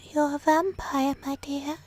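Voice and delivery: high, slow theatrical voice; put-on spooky revealer. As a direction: reveal it slowly in a high, hammed-up voice. Mock vampire revelation voice.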